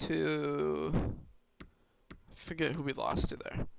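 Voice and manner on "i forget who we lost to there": normal talking voice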